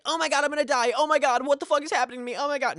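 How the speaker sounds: high-pitched, loud mock panic; panicked freak-out. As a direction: act out a frantic bad trip, fast and shrill-pitched. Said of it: "Not tweaking like".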